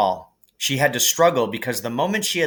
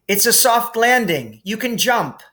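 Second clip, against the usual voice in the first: higher, louder call upward; called up from below; 11 semitones higher, 4 dB louder than usual. A narrator's reading. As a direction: shout reassurance up the shaft. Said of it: "he called up to the light".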